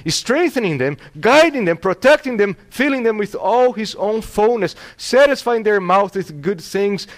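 fervent preaching, loud and high; Spurgeon. Acted. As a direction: build the list to a soaring peak, then ease down. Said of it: "I love what Spurgeon says".

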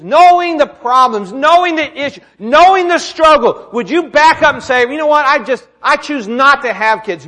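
forceful preaching. Acted. Preacher challenging parents about their choice, forceful. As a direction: hammer each repeated KNOWING.

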